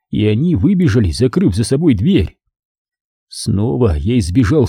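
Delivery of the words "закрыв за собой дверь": usual speaking voice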